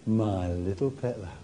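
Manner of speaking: soft, low and tender; gently whispered endearment; loving smile. Shown as a demonstration. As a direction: say it like cradling a lamb. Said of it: "with anything other than a loving smile".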